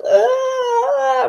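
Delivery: high, sharp mock scream; a quick high yelp; retold scream. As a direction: let out a short shriek as you retell it. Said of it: "and I was like".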